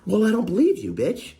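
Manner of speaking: very high-pitched mocking attack; imitated squealed taunt; mock going at her. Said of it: "going at her".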